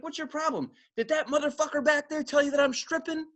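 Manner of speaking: high-pitched imitation of an angry woman; mock yell. Stripper accusing coworker to her boyfriend, mock yell. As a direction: accuse in a high voice, barely yelling. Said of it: "she just yells out".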